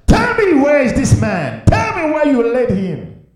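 high, loud pulpit demand; preacher's ringing high shout; full-force preaching. Acted.

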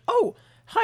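chirpy high greeting; high clerk voice. Retold or imitated. Clerk voiced in retold story.

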